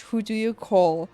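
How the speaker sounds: ordinary delivery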